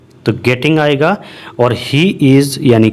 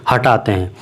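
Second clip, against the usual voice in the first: ordinary talk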